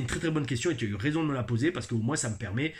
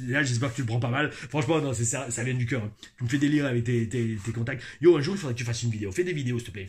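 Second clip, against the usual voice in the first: normal talking voice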